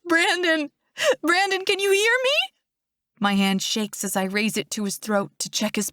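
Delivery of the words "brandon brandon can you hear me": high-pitched, tearful calling; desperate, high sobbing plea. A narrator's reading. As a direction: call out to someone dear through sobs. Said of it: "I sob".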